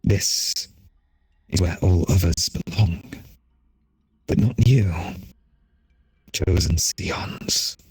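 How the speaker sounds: slow, tempting otherworldly voice; swarm queen voice. Acted. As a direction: offer dark power, coaxing.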